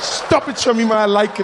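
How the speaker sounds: high, loud squeal of delight; mock protest. Comic begs Shamima to stop licking, high and giddy. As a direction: protest loudly while plainly enjoying it. Plays himself flustered by the woman.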